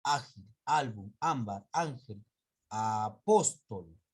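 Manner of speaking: Ágil, álbum, ámbar, ángel, apóstol each said slowly, stress on the first syllable of the first four; slow stress demo. Shown as a demonstration. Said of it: "en la penúltima".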